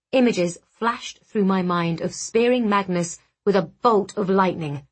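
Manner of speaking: usual speaking voice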